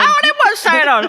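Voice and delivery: high, loud cockney urchin voice; old London cockney. Retold or imitated. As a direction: shout it as a Victorian cockney urchin. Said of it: "I just became, like, a Victorian urchin".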